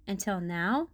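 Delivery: ordinary delivery, nothing marked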